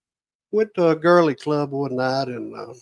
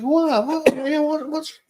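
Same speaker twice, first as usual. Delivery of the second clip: baffled protest; 11 semitones higher, slower than usual. Retold or imitated. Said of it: "He said".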